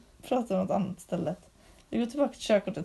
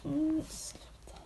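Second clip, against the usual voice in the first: slow whispered plea; 6 semitones higher, 9 dB quieter, much slower than usual. Real, not acted. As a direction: quietly beg her to stop. Whispered stop.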